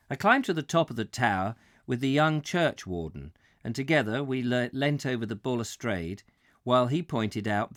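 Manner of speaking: measured, projected audiobook reading; story narration. A narrator's reading. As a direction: read the memoir aloud with careful phrasing. Reads book chapter aloud.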